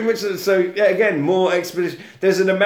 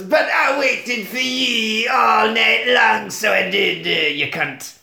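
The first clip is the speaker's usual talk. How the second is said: slow, drawn-out Scottish wail, falling away; mock Scottish grandfather; 4 semitones higher than usual. Grandfather scolding her for staying out, mock Scottish wail. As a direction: start high and stretched, then sink into grumbling.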